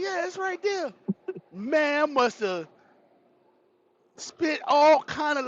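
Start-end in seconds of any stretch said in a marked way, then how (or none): 0.0-0.9 high-pitched imitation of a helpful woman. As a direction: lift it high for her reply. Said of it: "She like".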